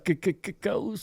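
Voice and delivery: high, stuttered spooky voice; spooky ghost stutter. Pretend. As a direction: stammer it like a scared ghost.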